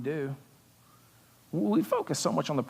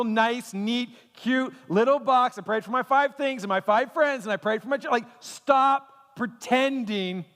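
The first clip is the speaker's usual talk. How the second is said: preaching at full force; 7 semitones higher, slower than usual. Real, not acted. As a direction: drive it home to the congregation. Preaching climax.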